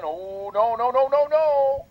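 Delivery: rising, mock-fussy protest; mocking naysayers. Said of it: "every time there's something done".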